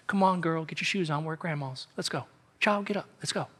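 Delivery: soft fatherly murmur at the end of a road trip; gentle dad waking daughter. Pretend. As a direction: coax a sleepy child awake, like it's nothing. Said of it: "like a dad waking a daughter up".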